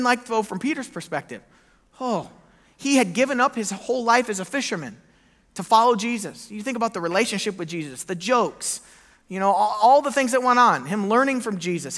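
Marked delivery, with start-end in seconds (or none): none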